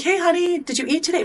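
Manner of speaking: high, sugary motherly voice; a doting mom fussing over her son; sweet mom greeting. Retold or imitated.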